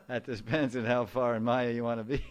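laughing. Real, not acted. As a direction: say it while cracking up. Laughing through the line.

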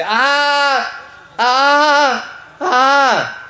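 three long, high-pitched wails; imitated cry of pain. Mother Earth scraped by razor hooves, acted wail. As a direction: wail out as if being scraped raw. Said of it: "Mother Bhumi would say".

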